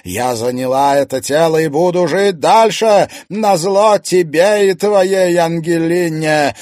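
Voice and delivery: loud, high, spiteful rant; angry, ringing accusation; furious tirade. A narrator's reading. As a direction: berate a husband with building defiance. Vengeful spirit berates her husband.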